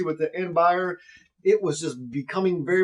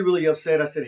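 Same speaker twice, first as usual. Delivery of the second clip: normal talking voice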